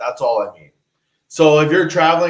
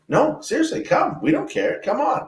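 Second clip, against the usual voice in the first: mock welcoming locals; 12 semitones higher, 4 dB quieter than usual. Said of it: "they're like".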